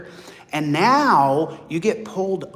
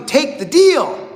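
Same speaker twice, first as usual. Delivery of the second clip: higher, louder, slow insistence; mock lawyer pressure; 7 semitones higher, 5 dB louder, slower than usual. Lawyer pushing the plea deal, insistent mock shout. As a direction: lean on the client hard. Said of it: "people are screaming at you".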